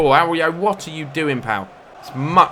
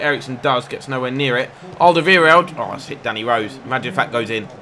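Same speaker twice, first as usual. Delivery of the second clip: ordinary talk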